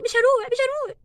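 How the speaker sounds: plead, refusing to go; crying girl insisting to mother she won't go back; tearful protest. Acted. Daughter refuses school.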